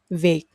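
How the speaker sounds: "việc" starting with v as in very; standard V sound. Shown as a demonstration. Teacher models the standard way, "việc" with a v. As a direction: say "việc" with an English v. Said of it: "like when you say very in English".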